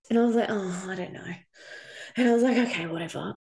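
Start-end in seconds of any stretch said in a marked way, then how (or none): 0.5-1.3 exhausted hesitation. Retold or imitated. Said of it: "I was just so exhausted". 2.6-3.3 softer, worn-out "whatever"; tired resigned shrug in the voice; exhausted giving in. Retold or imitated. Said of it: "I was just so exhausted".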